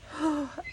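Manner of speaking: high, falling gasp of relief; imitated tearful gasp. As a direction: gasp as if overcome. Acting his crying with relief.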